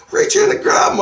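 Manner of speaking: very high, loud insistent plea; high pleading. Retold or imitated. Dreamer begging Jesus to grab Rusty, high and pleading. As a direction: beg him to just grab Rusty, squeaky. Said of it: "And I go".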